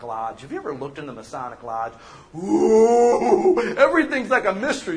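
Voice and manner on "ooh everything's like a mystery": mock spooky mystery. Mocking lodge secrecy.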